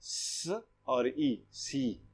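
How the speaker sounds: स और ई drawn out very slowly, then सी said quietly; slow blending. Shown as a demonstration. Teacher slowly blends letters for the students. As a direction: sound out each part slowly, then join them. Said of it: "तो ये क्या बन गया?".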